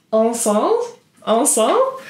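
trying out "Ensemble" in a self-mocking French accent, rising like a question; shitty French accent. Shown as a demonstration. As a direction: sound out "Ensemble" the French way, testing it. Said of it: "my shitty French accent".

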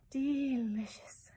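slow, drawn-out sigh of pleasure; blissful, long-drawn delight; happy sigh. A narrator's reading. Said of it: "with a happy sigh".

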